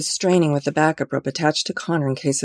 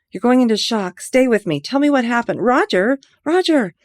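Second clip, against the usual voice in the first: high, loud desperate urging; urgent shouting; 9 semitones higher, 5 dB louder than usual. Acted. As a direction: keep him awake by shouting. Ivy urging dying man.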